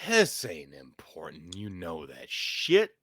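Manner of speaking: slow, low, menacing whisper; whispered beast voice. Acted. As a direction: hiss it like the beast within. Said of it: "your beast after your own amazing Chicago accent".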